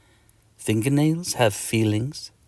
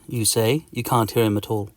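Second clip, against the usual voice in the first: ordinary delivery, nothing marked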